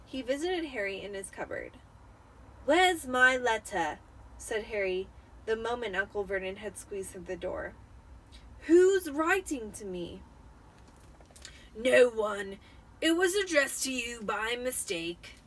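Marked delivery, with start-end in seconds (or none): 2.7-4.0 put-on loud, slow boy's demand; Harry demanding. Boy confronting his uncle in the cupboard, loud and slow. 8.7-10.2 put-on higher, louder boy's question; Harry pressing. Boy pressing his uncle about the letter, higher and louder. 11.8-15.3 short, loud brush-off; curt uncle. Acted. Uncle brushing off the boy, curt and loud. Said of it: "said Uncle Vernon shortly".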